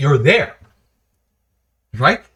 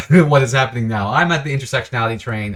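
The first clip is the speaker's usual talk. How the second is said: normal talking voice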